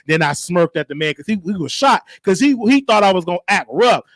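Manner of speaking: ordinary talk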